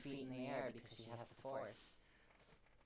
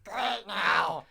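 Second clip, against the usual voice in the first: Donald Duck voice; 7 semitones higher, 20 dB louder than usual. Acted.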